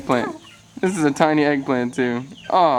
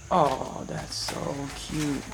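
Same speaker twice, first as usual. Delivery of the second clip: cooing; 7 dB quieter, much slower than usual. Real, not acted. Cooing at baby birds.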